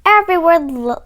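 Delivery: usual speaking voice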